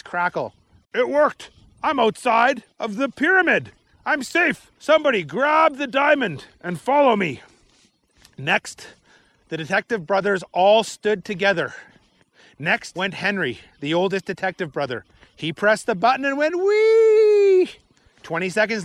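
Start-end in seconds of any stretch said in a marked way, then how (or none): 0.9-7.4 excited shout. Acted. Chief calls over walkie-talkie. 16.6-17.7 a long, high, loud drawn-out "wee"; playful high sliding cheer; gleeful slide whoop. A narrator's reading. Narrator voices slide whoop.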